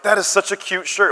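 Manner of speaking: put-on high, gushing girl voice; imitated barista girl. Barista admiring the daughter's shirt, imitated gushing voice. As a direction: coo over it in mock American girl-speak. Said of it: "And the girl's like".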